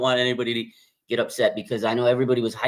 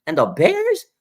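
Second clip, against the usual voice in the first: mock disbelief; 4 dB louder, slower than usual. Real, not acted. Mocking weak opponents on schedule.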